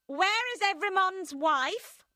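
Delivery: high, loud, slow demand. A narrator's reading. Madame Defarge confronting Miss Pross, loud demand. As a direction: interrogate coldly. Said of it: "she demanded".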